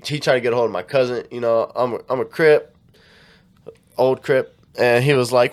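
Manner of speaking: usual speaking voice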